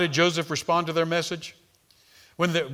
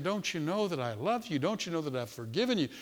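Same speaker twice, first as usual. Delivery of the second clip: tender Jesus; 9 dB quieter than usual. Acted. Jesus voiced weeping tenderly.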